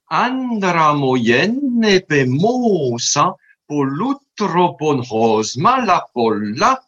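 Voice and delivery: "Andra moyen nepe moussa" chanted slowly, pitch rising and falling on the accents; melodic pitch accents. Shown as a demonstration. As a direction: swing "Andra moyen nepe moussa" like slam, long vowels, rolled r, melodic accent curves. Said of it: "Si je mets les accents qui sont marqués". (« Andra moyen nepe moussa » scandé lentement, accents mélodiques montants et descendants)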